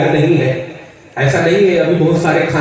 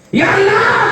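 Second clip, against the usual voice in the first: calling out; slower than usual. Acted. Soul calls out to God.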